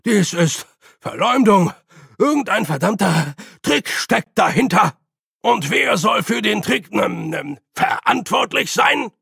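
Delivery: höher und lauter donnern; wütend den Saal anbrüllen; donnernd. Acted. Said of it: "donnerte Ridcully". (Ridcully donnert wütend, höher und lauter)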